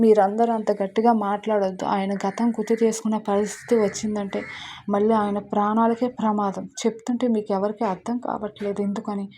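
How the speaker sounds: usual speaking voice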